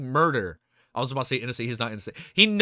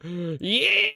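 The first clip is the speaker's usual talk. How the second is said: ordinary talk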